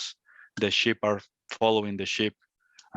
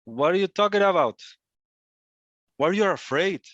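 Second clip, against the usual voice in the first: higher, louder incredulous demand; wild lion confronting; 8 semitones higher, 4 dB louder than usual. Acted. Wild lion questions the coward lion, confronting. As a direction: challenge the cowardly lion in a raised pitch.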